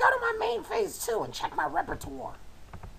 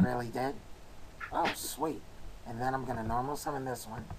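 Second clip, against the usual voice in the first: raspy voice; 8 dB quieter than usual. Real, not acted. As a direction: voice going hoarse and raspy. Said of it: "My voice is going rasp".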